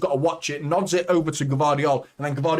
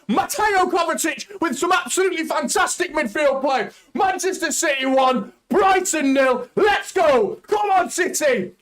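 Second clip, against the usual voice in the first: goal celebration shouting. Real, not acted. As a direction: scream with joy as the ball goes in. Celebrating his team's goal.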